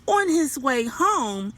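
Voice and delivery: ordinary delivery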